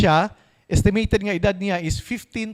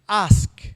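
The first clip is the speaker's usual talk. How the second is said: "Ask" stressed, a little louder; with emphasis; 3 dB louder than usual. Shown as a demonstration. Preacher stressing God's command while reading the verse, emphatic. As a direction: give "Ask" weight, a firm single word. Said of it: "Kantok emphasis".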